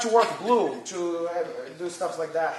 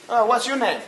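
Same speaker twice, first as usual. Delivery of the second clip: ordinary talk, nothing marked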